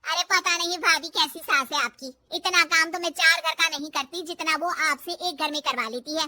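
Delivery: loud, gossipy housemaid; a hint of an outspoken maid; lightly voiced maid. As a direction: complain loudly on the bahu's behalf.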